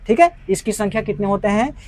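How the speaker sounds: ordinary talk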